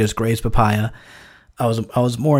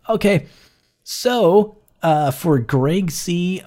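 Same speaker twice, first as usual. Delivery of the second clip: normal talking voice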